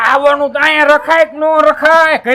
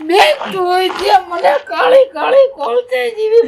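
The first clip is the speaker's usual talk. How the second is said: high, crying shout; mock wailing complaint; 6 semitones higher than usual. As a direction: bawl the grievance in a hammed-up sob. Mock wailing at wedding send-off.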